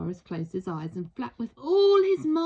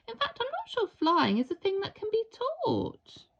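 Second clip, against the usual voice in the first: put-on higher child voice; lightly voiced young boy; 10 semitones higher than usual. A narrator's reading. Boy explaining flying to the dinosaur.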